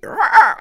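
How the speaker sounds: loud, high roar; dad's grabbing roar, imitated loud; mock roar. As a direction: roar like a raging beast. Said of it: "He was just like".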